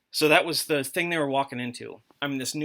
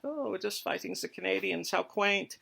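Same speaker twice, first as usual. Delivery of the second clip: higher, mock-smug soldier joking with buddies; German soldier mocking Canadians, higher and lightly smug; 5 semitones higher than usual. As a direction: sneer at the Canadians lightly, pitched up. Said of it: "joking with your buddies that it's like".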